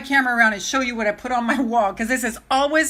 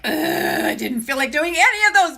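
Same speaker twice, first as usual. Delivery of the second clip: higher, mock-whiny reluctance; reluctant groan; 5 semitones higher, 4 dB louder than usual. Telling viewers about dreaded calls, mock reluctant groan. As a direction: groan it out like an unwanted chore. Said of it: "Okay, so I'm like".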